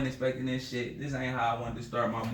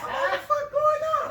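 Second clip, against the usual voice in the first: panicked high squeal; 6 dB louder than usual. Retold or imitated. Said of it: "I'm like".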